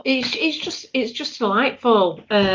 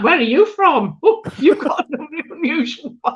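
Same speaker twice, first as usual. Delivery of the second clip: higher-pitched nosy-fan imitation; mock curious stranger; 6 semitones higher than usual. American fan quizzing the English visitor, mock fascinated. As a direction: act out a stranger intrigued by an accent. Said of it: "they just love the English accent".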